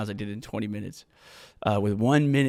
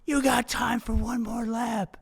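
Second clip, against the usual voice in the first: higher, slow coaxing coach voice; Jason urging; 10 semitones higher, slower than usual. Retold or imitated. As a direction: imitate Jason nudging him to go on. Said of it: "and Jason goes".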